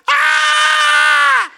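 long, high, loud mock scream; freaked-out scream. Husband imitating his wife freaking out on the ride, screaming. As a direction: imitate a terrified rider screaming, held on one note. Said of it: "She's freaking out".

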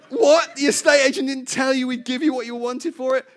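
loud, stunned disbelief, pitch up high; incredulous outburst. Retold or imitated. Preacher retelling shock at the buyers, loud incredulous outburst. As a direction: blurt it out like you can't believe it.

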